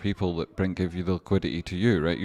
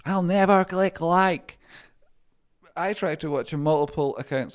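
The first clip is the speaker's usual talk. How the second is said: mock chat voice; 11 semitones higher, 5 dB louder than usual.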